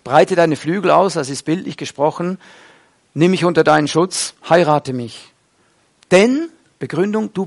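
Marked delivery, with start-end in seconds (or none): none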